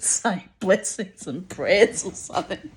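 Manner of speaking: normal talking voice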